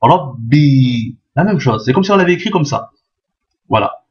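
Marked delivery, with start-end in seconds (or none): 0.0-1.2 "Rabbi" blocked on the b, then a long i; doubled consonant. Shown as a demonstration. Teacher demonstrating the shedda, "Rabbi" with doubled b, long i. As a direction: stop on "Rab", then release a held "bi". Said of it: "on bloque d'abord la consonne".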